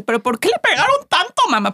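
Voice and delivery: put-on high, childlike worried question; a mother voicing her son's high worried question; imitating worried little boy. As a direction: play the small son upset in church. Son asks about Jesus.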